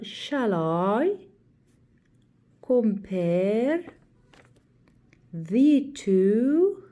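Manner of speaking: "shall I compare thee to a summer day" in slow, separated iambic beats; exaggerated syllable stress. Shown as a demonstration. Teacher demonstrating iambic feet to girls, "shall I... compare..." slowly stretched beat by beat. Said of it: "stress a syllable".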